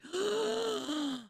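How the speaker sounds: sharp high gasp; shocked gasp. Real, not acted. Streamer reading a friend's hospital news, sharp shocked gasp.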